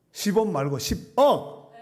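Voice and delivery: final "억" punched loud and high, set apart from "십"; stress on final 억. Shown as a demonstration. Preacher correcting a congregation that misheard the sum, final "억" punched. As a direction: break before the last "억" and hit it hard. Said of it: "십 원 넣어 들으셨구나".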